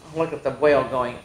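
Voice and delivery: plain reading voice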